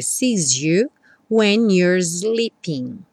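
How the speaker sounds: very slow, "sees" ending in Z into "you"; Z-linked sees you. Shown as a demonstration. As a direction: buzz the S of "sees" as Z. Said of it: "ficou um som como um Z". (S de "sees" soando como Z)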